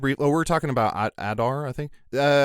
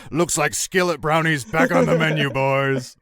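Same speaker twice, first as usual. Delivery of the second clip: orc voice; 3 semitones higher, 4 dB louder than usual. Pretend. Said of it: "parody of orc line".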